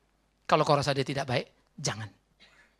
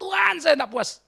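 high, raised cry easing off at the end; retold cry to God; 13 semitones higher, 7 dB louder than usual. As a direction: call out to God in frustration, as a retelling. (seruan kepada Tuhan, ditirukan)

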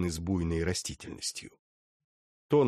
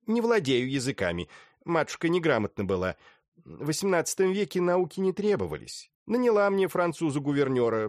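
put-on chatty old lady voice; 6 semitones higher than usual. Old widow rambles about tutor.

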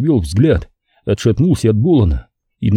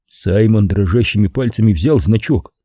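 plain reading voice

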